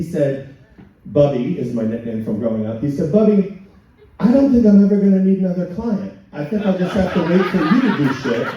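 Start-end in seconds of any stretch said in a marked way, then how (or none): 3.1-8.5 mock lawyer friend. Friend David quoted teasing.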